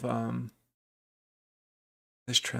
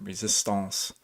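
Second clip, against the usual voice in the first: "resistance" said the French way, ree-zees-TAHNCE, lightly; a light French accent on "resistance"; 3 semitones lower, 4 dB quieter, slower than usual. Shown as a demonstration. A trader on stream says "resistance" in a mild French accent. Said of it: "I like saying resistance with a French accent".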